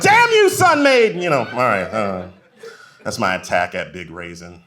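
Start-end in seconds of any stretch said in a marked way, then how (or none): 0.0-1.1 high, slow hammed-up curse; mock outrage. Shopper cursing the raisin brand, hammed-up high yell. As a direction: shake your fist at the raisin brand. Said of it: "you're like".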